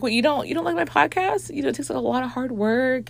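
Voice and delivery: high, hurt, defensive squeak; hurt friend. Retold or imitated. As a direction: play the wounded friend, pitched well up and quickening. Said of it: "She's like".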